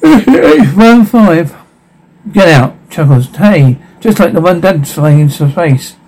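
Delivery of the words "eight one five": giggling, high and slow; laughing. Acted. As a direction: laugh through the words. Kid laughing while showing plane.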